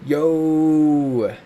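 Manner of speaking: usual speaking voice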